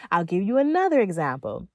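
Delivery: ordinary delivery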